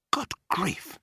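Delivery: startled, high yelp; shocked cry. A narrator's reading. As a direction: cry out in horror at a discovery. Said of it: "he cried".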